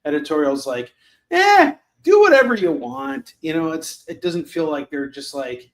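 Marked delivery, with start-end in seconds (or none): 1.3-3.3 high, loud, shrugging "whatever"; mock careless editor. Mocking careless editors brushing off the artist, high and loud. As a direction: toss it off like a bored editor who doesn't care.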